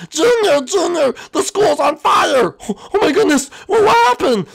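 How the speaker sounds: high panicked shout that the school's on fire; alarmed shout. Acted. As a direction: yell the alarm, very high and loud.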